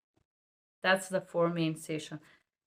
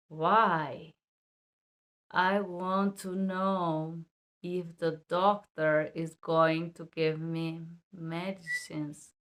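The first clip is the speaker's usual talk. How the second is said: too slow; much slower than usual. Shown as a demonstration. Teacher acting a too slow interpreter, very slow. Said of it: "Another bad".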